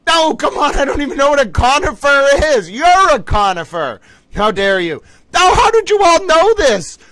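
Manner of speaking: high, loud exasperated squeal; exasperated outburst. Real, not acted. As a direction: cry out in disbelief at the question. Outburst at hard question.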